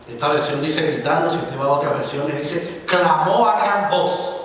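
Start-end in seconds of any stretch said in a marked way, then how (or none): 2.9-4.3 slow, loud and high; proclaiming. Acted. Preacher reading other version, proclaiming loudly. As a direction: boom it out slowly.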